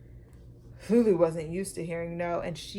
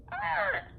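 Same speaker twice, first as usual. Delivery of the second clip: high screeching cry. Acted. Lulu screeching at her parents, high piercing wail. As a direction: shriek like a spoiled child. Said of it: "Lulu would screech".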